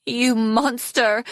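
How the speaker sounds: high, drawn-out outraged cry; cried accusation. A narrator's reading. Snowflake diving at Arctic, crying out in outrage. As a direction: hurl it at him, slow and high. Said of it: "Snowflake cried".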